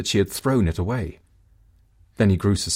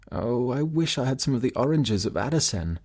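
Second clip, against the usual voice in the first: sick wife wishing; 4 semitones higher, 3 dB quieter than usual. Acted. Wife wishes again.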